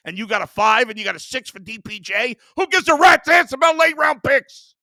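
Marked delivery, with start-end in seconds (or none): 2.6-4.8 a real full-volume shout, pitched much higher; furious shout. Real, not acted. Angry host yelling at fans who praise late round picks, furious shout. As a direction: bellow it, fed up with the praise for the trade. Host raging about the trade.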